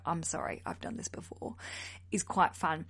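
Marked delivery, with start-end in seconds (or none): 0.1-1.6 soft, low murmured excuse; hushed apology. Acted. Student excusing herself to the yoga instructor, hushed. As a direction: drop to a near whisper to apologise.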